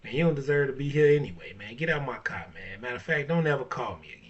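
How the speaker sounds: dismissive rant. Retold or imitated. Dismissing the gold digger from his car, loud angry rant. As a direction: kick her out of the car, loud. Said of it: "I'd be like".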